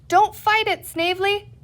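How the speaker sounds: high, loud call over the water; cried out. A narrator's reading. Patsy calls to Snavely struggling in the river, loud. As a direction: shout to the snake in the river. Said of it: "cried Patsy".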